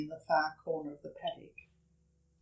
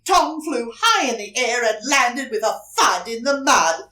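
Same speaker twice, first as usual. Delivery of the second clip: louder, excited storytelling; dramatic burst; 4 semitones higher, 9 dB louder than usual. A narrator's reading. Telling children the kick, loud and excited. The cow kicks Tom.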